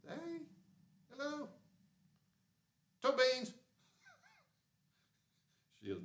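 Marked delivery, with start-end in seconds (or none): 0.1-3.5 very high cutesy voice, last words loud; pet voice. Real, not acted. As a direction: squeal it for the cat. Making cat greet everyone.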